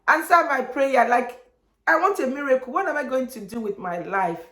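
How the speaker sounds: high pleading that comes down at the end; imploring mock prayer; desperate prayer. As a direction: cry out to heaven, retold.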